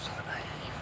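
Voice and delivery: very soft whisper under the breath; whispered aside. Real, not acted. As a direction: whisper it to yourself. Muttered aside, whispered to himself.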